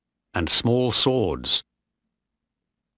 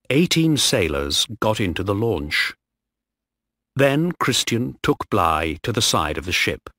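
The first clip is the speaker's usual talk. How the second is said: ordinary delivery, nothing marked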